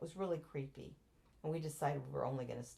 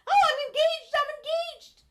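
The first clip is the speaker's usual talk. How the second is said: much higher and louder, squealing with joy; giddy squeal; 15 dB louder than usual. Retold or imitated. Newly engaged woman running around after the proposal, giddy squeal. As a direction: shriek it like a giddy fool. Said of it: "I ran around like a fool".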